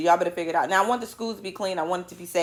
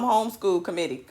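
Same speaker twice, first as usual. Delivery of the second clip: ordinary talk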